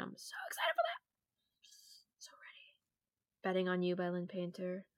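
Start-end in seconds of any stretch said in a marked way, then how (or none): 0.0-2.8 whispering. Real, not acted. Excited whisper about a book.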